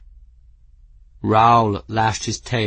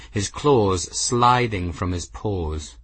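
ordinary delivery

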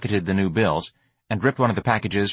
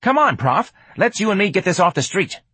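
put-on higher, lively street-kid voice; streetwise young hustler, eager; 11 semitones higher, 4 dB louder than usual. George urges the professor off the street, eager street voice. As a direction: pitch it up, casual and pushy.

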